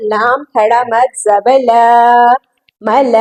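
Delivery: "ल" and "मल" ending on a long held aa; long madd. Shown as a demonstration. Teacher spelling out a long madd, "मल" greatly prolonged. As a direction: draw out the vowel ending "मल". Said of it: "बहुत खींच कर पढ़ना है".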